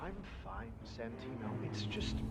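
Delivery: very soft, low murmur; quietly upset. Acted. Sommelier reassuring Santino while upset over the wine, quietly.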